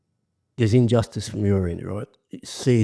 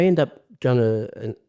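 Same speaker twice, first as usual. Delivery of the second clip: normal talking voice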